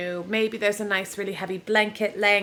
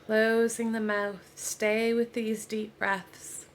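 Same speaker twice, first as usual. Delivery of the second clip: guided meditation; 3 dB quieter than usual. Real, not acted. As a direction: ease them into rest, unhurried, with long pauses. Leads a yoga nidra relaxation.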